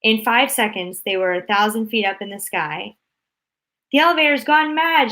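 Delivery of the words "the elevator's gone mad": high, loud outcry; frightened shout. A narrator's reading. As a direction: yell it in alarm. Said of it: "shouted Grandpa Joe".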